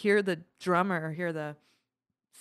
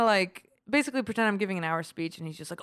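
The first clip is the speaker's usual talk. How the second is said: usual speaking voice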